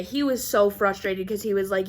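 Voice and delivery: ordinary talk, nothing marked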